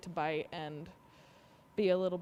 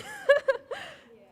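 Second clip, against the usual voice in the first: short laugh; 9 dB louder than usual. Real, not acted. Vet amused while sedating cat.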